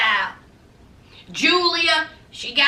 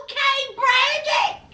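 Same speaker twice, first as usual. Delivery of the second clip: impressed okay; 8 semitones higher than usual. Retold or imitated. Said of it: "I said".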